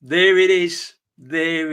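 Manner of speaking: loud, excited reveal; real excited shout; triumphant. Real, not acted. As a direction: call it out loud to celebrate the news. Reveals the big transfer news.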